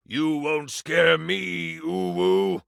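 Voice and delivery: slow, drawn-out bravado from a scared guard; a guard faking courage; trembling guard feigning bravery at the player; slow mock-defiant guard. Game master voices defiant guard.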